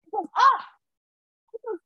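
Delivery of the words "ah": high, loud gasp of delight; overjoyed vicar. Retold or imitated. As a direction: act out a thrilled "ah". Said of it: "so happy for me".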